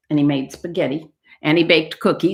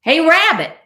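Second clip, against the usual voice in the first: high, loud call from outside; yelling; 11 semitones higher, 5 dB louder than usual. Acted. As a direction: holler it out to the rabbit. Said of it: "someone yelled outside".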